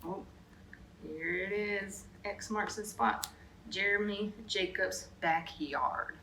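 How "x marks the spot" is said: ordinary delivery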